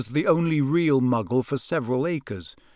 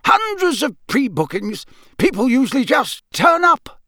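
high-pitched, puzzled local; Mister Roberts; 10 semitones higher, 4 dB louder than usual. Acted. Campsite keeper on the sudden crowds, high-pitched. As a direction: marvel in a high character voice.

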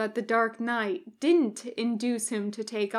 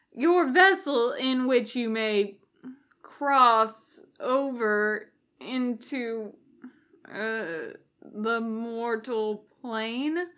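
fumbling; slower than usual. Acted. Nervous cultist fumbling.

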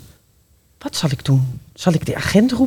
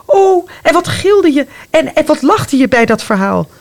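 excited cry; 7 semitones higher than usual. Acted. Remembering childhood fright.

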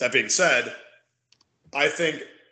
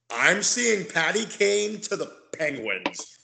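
slow, drawn-out spooky storyteller voice; slow and eerie, like a late-night rumor; witching hour voice; 6 semitones higher, slower than usual. Pretend. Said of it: "my witching hour voice".

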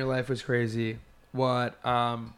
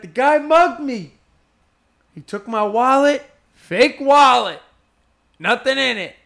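gleeful exclamation; 10 semitones higher, 9 dB louder than usual. Retold or imitated. Imitating mugged comedian telling his boys, gleefully hysterical. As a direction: crow each phrase as a punchline.

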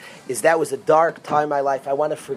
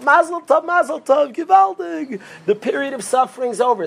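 much higher, louder gleeful mazel tovs; excited congratulations; 9 semitones higher, 4 dB louder than usual. Retold or imitated. The prophet bursts in congratulating the freed sage, gleeful cheer. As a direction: burst in cheering the good news. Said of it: "It's not what he says".

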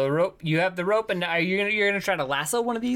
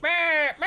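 sheep bleat; 11 semitones higher than usual. Retold or imitated. Sheep noise imitated.

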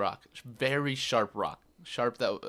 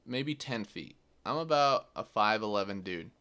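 normal talking voice

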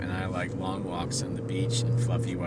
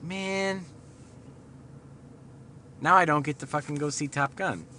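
whiny disappointed; 6 semitones higher than usual. Retold or imitated. His own disappointed inner voice.

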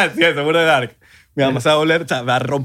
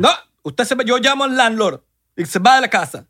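high scolding mother, higher and firm; mock stern mom; 7 semitones higher, 3 dB louder than usual. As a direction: scold him like an angry mom. Voicing mom in acted quarrel.

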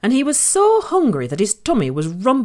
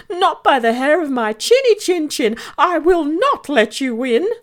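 high piggy voice, raised; defiant little pig; 9 semitones higher than usual. A narrator's reading. Little pig defies the wolf, high and squeaky.

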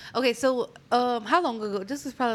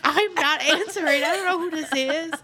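higher, louder put-on voice; high mock panic; 8 semitones higher, 4 dB louder than usual. Dodging a stranger's call, high mock panic. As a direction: squeal it like you're dodging the call. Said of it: "And I was like".

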